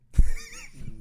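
shrieking burst of laughter; loud high laugh. Real, not acted. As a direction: cackle loudly up high.

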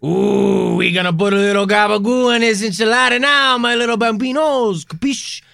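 hammed-up Italian mobster accent; Italian mafia accent. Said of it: "very Italian".

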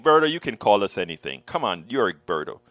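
lightly voiced friends waving off his apology; friends brushing it off. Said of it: "And they're like".

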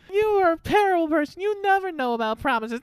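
put-on a kid wailing down the phone; mock upset child, hammed-up; whiny kid. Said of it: "Jake Lloyd basically goes".

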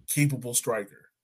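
ordinary talk, nothing marked

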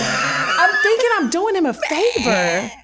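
high, drawn-out exclamation; incredulous outburst. Real, not acted. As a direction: cry out at your own mistake. Exclaims at her own mistaken belief.

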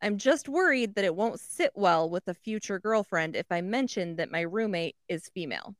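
ordinary talk, nothing marked

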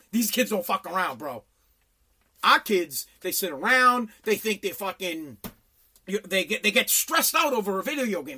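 ordinary delivery

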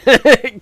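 loud high laugh; a sudden laugh at the mix-up; burst of laughter. Real, not acted.

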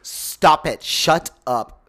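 loud, emphatic refusal to believe it; loud disbelief. Real, not acted. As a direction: cut the other host off in disbelief. Disbelief at a required animated series.

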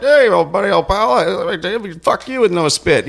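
high, hammed-up old-pal holler; mock chummy greeting. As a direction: imitate an over-friendly guy calling out. Acting out chummy intruders at sea.

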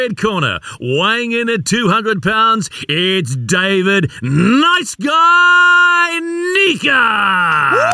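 booming ring announcer, long drawn-out name; boxing ring announcer. Pretend. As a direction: introduce the fighter to the arena. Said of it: "how does that sound".

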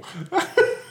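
high breathless giggling fit; helpless giggle. Real, not acted. Laughing at the co-host's eye roll, a high breathless giggle. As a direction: collapse into real giggles. Cracking up at co-host.

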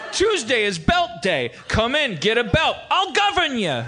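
high-pitched tailor-governor patter; hammed-up salesman. Comic as governor who sells suits, hammed-up high salesman. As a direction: sell it high, like a catchphrase.

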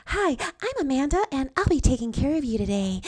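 high, gushing fake-friendly server voice; overly perky, super phony. Server introducing herself to a diner, overly perky and phony. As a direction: lay on the phony customer-service perk, way up high. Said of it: "in an overly perky, super phony tone".

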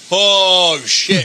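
mock dawning alarm. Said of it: "you're like".

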